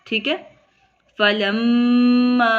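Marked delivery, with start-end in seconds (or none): 1.2-2.5 slow, drawn-out Quranic chant with held vowels; Quran recitation. Shown as a demonstration. Teacher reciting the verse for students, slow tajweed chant. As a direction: recite it slowly like tilawat. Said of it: "teacher recites verse in tajweed".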